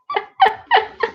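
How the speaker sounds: very high-pitched staccato giggle; high squeaky laugh. Real, not acted. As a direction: burst into squeaky, pulsing laughter. Laughs at drunks calling ex-partners.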